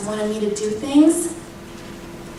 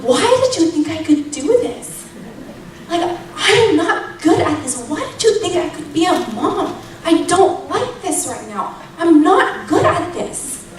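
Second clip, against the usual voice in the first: higher-pitched, exasperated protest to God; retold desperate complaint, pitched up; exasperated plea; 5 semitones higher than usual. Mom protests to God that she can't do this, exasperated plea. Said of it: "I'm dead serious in that moment".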